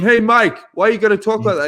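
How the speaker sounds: lightly voiced TV interviewer prodding. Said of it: "He's going".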